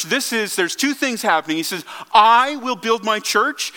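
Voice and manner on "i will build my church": "I" hit loud and high, then a slow "will build my church"; emphatic on I. Retold or imitated. Preacher quoting Jesus on whose church it is, emphatic. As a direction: punch the "I" to claim the church. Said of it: "He's very emphatic about whose it is".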